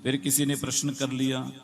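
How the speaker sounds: ordinary delivery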